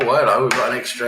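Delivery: usual speaking voice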